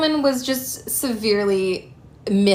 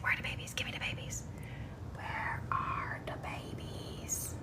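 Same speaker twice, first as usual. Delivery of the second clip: voicing the cat in a whisper; cat's voice whispered; 16 dB quieter than usual. Owner voicing the cat searching for kittens, whispered. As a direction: whisper her thoughts like a sneaky mother cat. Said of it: "She's like".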